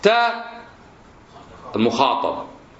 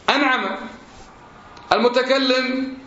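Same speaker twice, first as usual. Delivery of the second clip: normal talking voice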